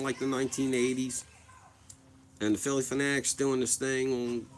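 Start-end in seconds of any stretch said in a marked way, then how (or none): none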